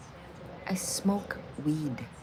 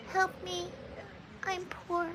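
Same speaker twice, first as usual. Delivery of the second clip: higher, soft pleading voice; much higher, softer mock plea; quoting the movie line; 12 semitones higher, 9 dB quieter, slower than usual. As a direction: beg sweetly and pitifully. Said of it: "the actress that did that line".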